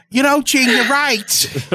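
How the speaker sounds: high-pitched rock star impression; way up high, showy agreement; hammed-up high rocker voice; Paul. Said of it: "That's right, Paul".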